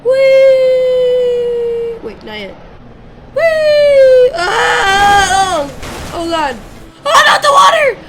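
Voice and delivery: high, very loud shrieks of delight and panic; screaming with glee. Real, not acted. Gamer shrieks as his car flies toward the water, high and very loud. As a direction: scream like you're on a rollercoaster.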